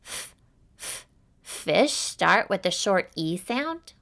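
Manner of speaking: "f, f" hissed short and unvoiced before "fish", slow; sounding out. Shown as a demonstration. Teacher sounding out fish for a quiz. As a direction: isolate the "f" of fish.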